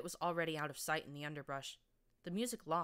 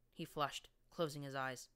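usual speaking voice

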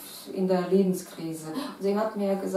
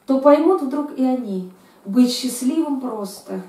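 raised, louder, declaiming recital; poem recitation; 5 semitones higher, 7 dB louder than usual. Acted. Poet reads her Russian poem aloud for the interviewer, declaiming. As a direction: read it like a stage poem.

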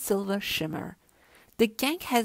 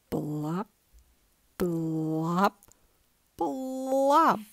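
blop sounds; 2 semitones lower, much slower than usual. A narrator's reading. Blop refrain.

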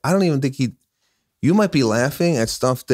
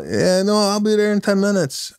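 much higher, breezy imitation; a friend happily saying yes; lightly voiced eager buddy; eager mock reply; 10 semitones higher than usual.

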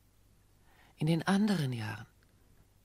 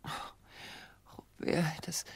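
breathless whisper; 7 dB quieter, much slower than usual. Acted. Woman moved by declaration.

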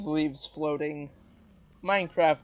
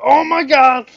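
loud, high-pitched outburst; startled shout; 6 semitones higher, 12 dB louder than usual. Real, not acted. Gamer cut off by a near miss with a dragon, startled shout. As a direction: shout it in sudden alarm. Cut off by sudden mishap in game.

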